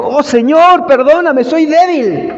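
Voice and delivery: Peter's broken, tearful cry to the Lord; weeping plea. Acted. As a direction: plead with the Lord as if in tears. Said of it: "Lloró. Y dijo".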